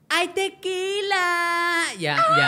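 high, slow squeal that jumps into a shout; drawn-out drunken squeal, then a shriek; drunk friend squealing. Retold or imitated. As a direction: mimic the tipsy friend shrieking for tequila. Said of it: "viene una amiga que dice".

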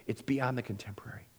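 quick whisper, breath only; whispered aside. Real, not acted. Preacher slipping the congregation a whispered aside. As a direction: drop to a hushed quick aside.

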